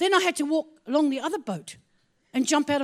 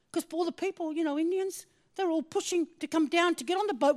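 ordinary talk, nothing marked

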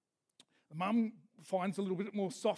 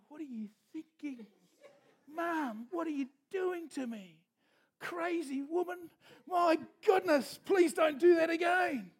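higher, put-out little bird voice; indignant baby eaglet; 7 semitones higher than usual. Acted. Rescued eaglet scolding mum, higher and indignant. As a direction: scold mum like a shaken chick.

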